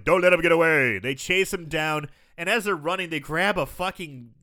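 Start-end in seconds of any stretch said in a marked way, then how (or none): 0.0-1.0 louder mock command; commanding shout. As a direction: order the men after him. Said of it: "Christopher Lee".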